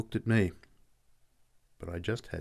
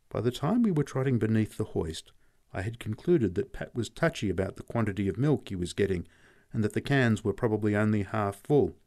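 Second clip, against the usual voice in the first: ordinary delivery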